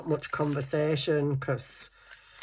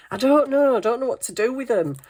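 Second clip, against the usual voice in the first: helpless; 7 semitones higher, 4 dB louder, faster than usual. Retold or imitated. Dad answering, laughing.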